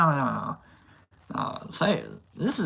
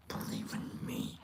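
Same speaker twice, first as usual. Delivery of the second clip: breathy whisper of Believe in me; 18 dB quieter than usual. Acted. Vlogger repeats the line to himself, whispered. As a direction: whisper it secretly.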